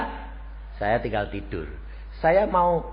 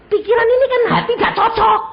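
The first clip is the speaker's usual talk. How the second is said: fast, much higher, through laughter; laughing aside; 12 dB louder, faster than usual. Real, not acted. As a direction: laugh it out quickly. Laughing aside about mismatch.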